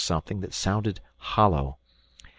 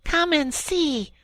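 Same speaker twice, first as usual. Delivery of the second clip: delighted; slower than usual. Acted. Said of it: "said the delighted Rat".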